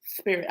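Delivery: "spirit" opening on a long lisping s; lisped "spirit". Shown as a demonstration. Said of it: "if you have a lisp".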